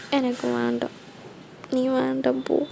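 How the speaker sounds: soft, pouty refusal; sulky. Acted. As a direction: push the fiancé away in a mild sulk. Bride sulkily rejects fiancé.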